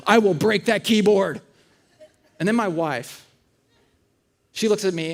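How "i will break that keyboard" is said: loud, slow mock threat; reenacted angry yell. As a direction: hammer out each word as an exasperated parent. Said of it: "I kept yelling".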